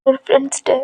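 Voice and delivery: distraught weeping mother; crying aunt. Acted. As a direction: sob it out in despair. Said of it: "உங்க anti செம்மையா அழுகுறாங்க".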